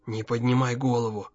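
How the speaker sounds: low, hushed warning; father's secretive undertone; whispered warning. A narrator's reading. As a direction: murmur softly to stay hidden. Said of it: "Прошептал".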